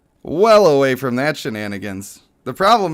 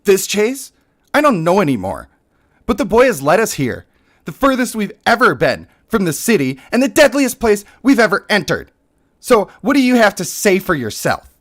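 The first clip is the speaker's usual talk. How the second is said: higher, louder, scornful commander demanding answers; bitchy leader; 8 semitones higher, 4 dB louder than usual. Acted. Streamer voicing the game's bitchy leader, higher and louder. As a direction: play her as a haughty, self-righteous boss. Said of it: "it's hard for me to be a bitch".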